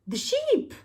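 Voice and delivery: high squeak. Acted. Thief recognises sheep.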